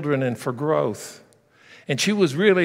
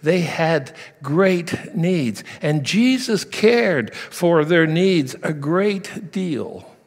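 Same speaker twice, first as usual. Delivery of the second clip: usual speaking voice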